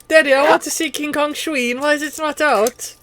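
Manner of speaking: high-pitched mock little boy; little Timmy. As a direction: pipe up like a child asking dad. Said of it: "it's like a little Timmy".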